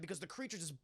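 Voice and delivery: normal talking voice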